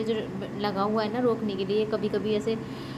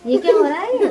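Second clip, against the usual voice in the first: higher, louder startled question; surprised exclaim; 7 semitones higher, 7 dB louder than usual. Real, not acted. Mother seeing her drenched child, surprised exclaim.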